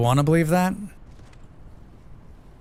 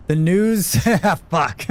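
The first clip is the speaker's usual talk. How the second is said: laughing; 5 semitones higher, much slower than usual. Real, not acted. Laughing at the bad news.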